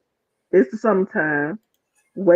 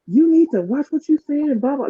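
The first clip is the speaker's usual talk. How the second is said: higher-pitched retold scolding; mock telling-off, pitched up; going off; 6 semitones higher than usual. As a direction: imitate someone starting an argument. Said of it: "starts going off".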